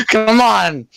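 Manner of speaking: high-pitched character whine; exasperated protest. Acted. Strange Claw protests being stopped.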